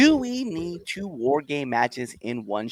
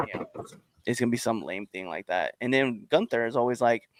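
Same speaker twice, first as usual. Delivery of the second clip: normal talking voice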